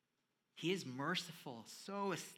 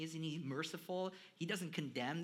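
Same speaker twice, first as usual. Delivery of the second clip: ordinary talk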